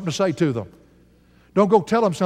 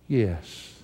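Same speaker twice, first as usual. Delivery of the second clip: quiet yes; 7 semitones lower, 6 dB quieter than usual. Acted. Girl gives up her pearls.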